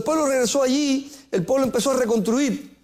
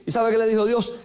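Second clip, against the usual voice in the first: ordinary talk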